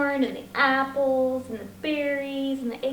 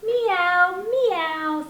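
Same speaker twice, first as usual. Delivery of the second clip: higher, louder playful meow; meowing cat; 5 semitones higher, 5 dB louder than usual. Acted. Storyteller voicing Cat for the children, mock meow. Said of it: "said Cat".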